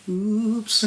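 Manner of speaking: high, sing-song fake innocence; mock innocent oops. Pupils to the fuming girl, high coy oops. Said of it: "we'd be sat there like".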